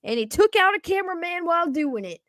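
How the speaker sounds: excited touchdown call, pitched high; sportscaster riding the run into the end zone; live play-by-play. Acted. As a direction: build from steady setup into a high, quick, loud call.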